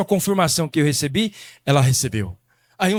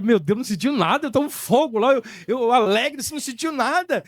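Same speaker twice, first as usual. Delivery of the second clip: high-pitched, baffled retold outburst; incredulous protest; 6 semitones higher than usual. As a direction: protest in mild disbelief, pitch climbing. Retells his disbelief at his wife. (incrédulo, protestando)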